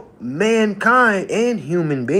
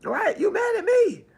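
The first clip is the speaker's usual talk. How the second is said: much higher, sing-song tease; high teasing; 14 semitones higher, 3 dB quieter than usual. Acted. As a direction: tease them, pitch jumps way up.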